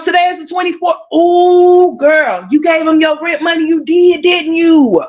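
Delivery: high, loud mock-scandalised call-out; teasing shout. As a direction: shout it like catching her red-handed. Calling out the girl's rent money.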